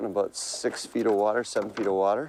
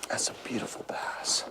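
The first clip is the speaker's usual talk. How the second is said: soft awed whisper; 3 semitones higher, 8 dB quieter than usual. Real, not acted. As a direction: whisper it in wonder. Admiring the caught bass.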